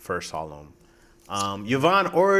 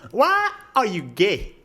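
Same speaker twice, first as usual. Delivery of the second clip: higher, slow, imitated African accent; high-voiced African accent; 6 semitones higher than usual. Shown as a demonstration. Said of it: "That's the closest one I can".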